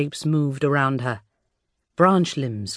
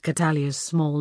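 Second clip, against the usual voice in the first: ordinary delivery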